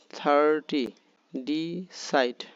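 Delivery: Thirty, decide slowly split in two; clapped-out syllables. Shown as a demonstration. Teacher models thirty and decide for clapping, syllables separated. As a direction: separate থার-টি and D-side with clear beats. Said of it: "প্রত্যেকটা হাততালি দেবে".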